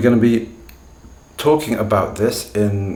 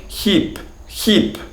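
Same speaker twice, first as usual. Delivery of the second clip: Russian accent; 11 semitones higher than usual. Shown as a demonstration. Teacher showing learners how hip and heap sound alike, Russian accent. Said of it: "when you listen to Russian speakers speaking English".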